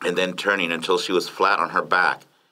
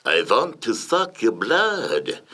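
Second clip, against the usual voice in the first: slow, raised-pitch phony Hungarian vampire; phony Hungarian accent; 4 semitones higher, much slower than usual. A narrator's reading. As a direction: stretch it out in a hammy Hungarian accent. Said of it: "the stranger spoke in a phony Hungarian accent".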